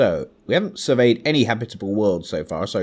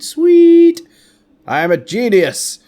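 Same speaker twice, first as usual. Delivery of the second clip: high, loud delighted squeal; excited squeal; 14 semitones higher, 9 dB louder, slower than usual. Real, not acted. Survey finished, thrilled cry.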